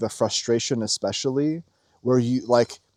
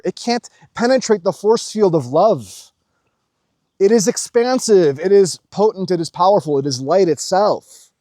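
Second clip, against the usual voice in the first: higher, louder sermon-like proclamation; preaching; 6 semitones higher, 5 dB louder than usual. Acted. Preaching to viewers about love, raised voice.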